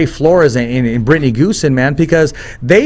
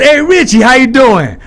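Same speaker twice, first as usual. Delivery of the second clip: loud, high East Coast hello; East Coast accent; 9 semitones higher, 5 dB louder than usual. Retold or imitated. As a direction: imitate an East Coast buddy's big greeting. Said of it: "No, he's East Coast".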